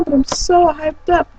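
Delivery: usual speaking voice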